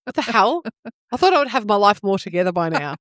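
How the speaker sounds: usual speaking voice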